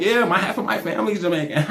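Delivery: soft, quick mimicry of her; retold casual boast. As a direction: toss it off fast and quietly, imitating her. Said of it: "She was like".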